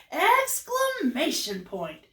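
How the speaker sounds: high, drawn-out and punchy; excited exclamation. Shown as a demonstration. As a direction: stretch it out with big excitement. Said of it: "really happy and excited".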